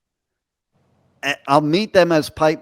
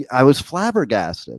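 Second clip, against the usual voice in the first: ordinary delivery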